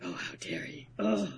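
low, hissed whisper of resentment; jealous whisper. Retold or imitated. Jealous underling glares at Soma, whispered seething. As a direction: seethe it under your breath.

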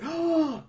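high, astonished gasp; mock gasp. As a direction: gasp as if stunned. Acts astonished reaction to arrival.

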